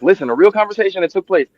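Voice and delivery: normal talking voice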